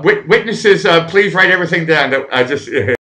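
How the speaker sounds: normal talking voice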